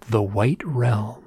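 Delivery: slow, hushed, weighty delivery; very dramatic. Retold or imitated. Researcher echoing his story back, very dramatic. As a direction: let each word land with theatrical gravity. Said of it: "very dramatically said".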